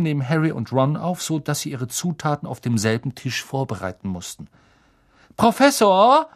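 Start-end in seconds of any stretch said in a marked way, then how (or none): 5.4-6.3 high, loud schoolboy call. A narrator's reading. Malfoy calls the potions teacher, high and loud. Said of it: "rief Malfoy".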